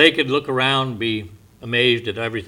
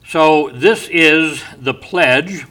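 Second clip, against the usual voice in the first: slow, loud, leaning on this; emphatic; 3 semitones higher, 7 dB louder, much slower than usual. Real, not acted. Pastor stressing the pledge to his flock, slow and loud. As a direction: plant the phrase for the congregation.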